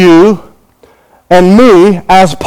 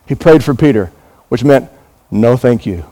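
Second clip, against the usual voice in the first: ordinary talk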